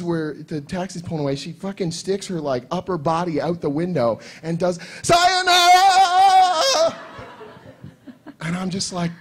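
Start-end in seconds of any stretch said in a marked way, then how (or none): 5.0-7.0 high, loud, long-drawn anime farewell; imitated anime shriek, stretched right out; cartoon character. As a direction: belt it out in a squealing cartoon voice. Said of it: "she's a cartoon character".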